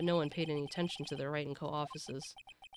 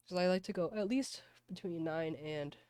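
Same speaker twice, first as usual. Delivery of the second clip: usual speaking voice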